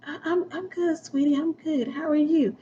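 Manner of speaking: soft, high, timid little voice; meek husband. Retold or imitated. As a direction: answer meekly like a docile husband.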